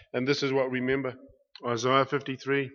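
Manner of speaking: usual speaking voice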